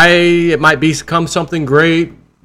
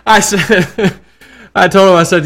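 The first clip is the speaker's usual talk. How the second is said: laughing; 6 semitones higher, 5 dB louder than usual. Real, not acted. Laughing at the guest's line.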